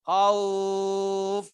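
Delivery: khauf with the vowel held long. Shown as a demonstration. Teacher demonstrating a long khauf to students. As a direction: stretch the au in khauf. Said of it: "Ini bisa dibaca panjangnya khauf".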